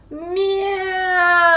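high meow like a startled cat. Acted. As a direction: yelp a meow as the tail is trodden on. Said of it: "went Mog".

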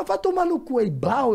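mock crying retort. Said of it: "aí eu chorando, falei".

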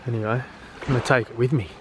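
normal talking voice

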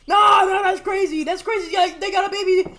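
loud, high alarmed shout. Real, not acted. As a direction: yell in alarm at the attack. Villagers attacked by baby zombie.

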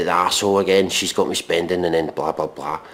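ordinary talk, nothing marked

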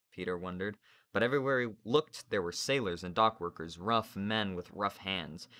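plain reading voice

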